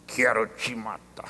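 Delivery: low, soft, slow demon threat; demon's man voice. Retold or imitated. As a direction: menace slowly in a deep demon voice. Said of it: "com aquela voz de homem". (voz de homem grave e lenta, ameaçadora)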